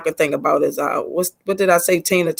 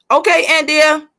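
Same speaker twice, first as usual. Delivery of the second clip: bright greeting; 9 semitones higher, 5 dB louder than usual. Real, not acted. Greeting a newcomer.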